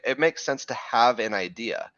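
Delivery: ordinary delivery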